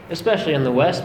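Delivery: ordinary delivery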